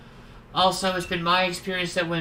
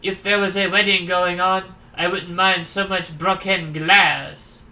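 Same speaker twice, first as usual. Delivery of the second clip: louder, hammed-up French accent; 5 dB louder than usual. Stranger remarking on the broken glass, French accent. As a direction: grumble in a French accent, dragging out the ending. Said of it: "we'll make him French".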